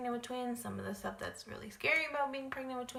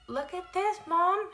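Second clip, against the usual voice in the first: excited technician; 10 semitones higher, 7 dB louder, slower than usual. Retold or imitated. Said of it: "And she's like".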